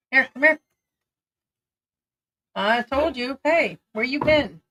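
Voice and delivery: usual speaking voice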